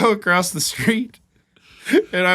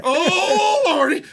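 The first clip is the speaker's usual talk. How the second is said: higher, whimpering oh Lordy; groaning big man; 9 semitones higher, slower than usual. Retold or imitated. Man in the next stall, high whimpering moans. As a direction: moan like you're in pain on the toilet. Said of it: "he's like".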